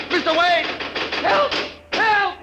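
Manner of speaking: loud, high cries for help; panicked shout for help. Acted. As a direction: yell for rescue, terrified.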